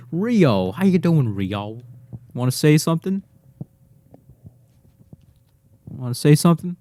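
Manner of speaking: cheerful dog-talk voice. Real, not acted. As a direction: coax the dog to speak. Talking to his dog.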